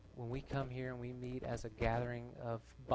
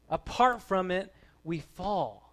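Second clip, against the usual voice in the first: raised, forceful voice dropping onto the last words; emphatic preaching; 6 semitones higher, 9 dB louder, slower than usual. Real, not acted. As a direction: proclaim it as a solemn warning. Climax of preaching, then repeated softly.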